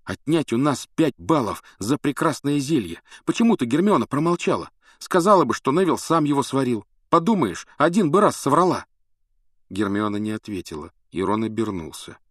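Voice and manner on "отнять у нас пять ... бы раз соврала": loud, high indignant outburst; furious rant. Acted. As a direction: vent the injustice loudly. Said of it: "Рон всё ещё кипел от гнева".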